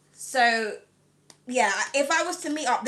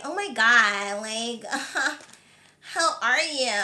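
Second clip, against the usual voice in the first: exaggerated, whiny American greeting; drawn-out mock American small talk with a little laugh; mock American accent; slower than usual. Shown as a demonstration. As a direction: parody an annoying American reality-star voice. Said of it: "I would sound like that".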